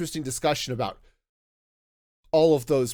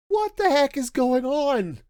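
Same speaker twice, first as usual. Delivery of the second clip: high-pitched, drawn-out mock exclamation; exaggerated baffled cry, pitched way up and slow; mock bewilderment; 12 semitones higher, 3 dB louder, slower than usual. Voicing the bewildered lower ranks.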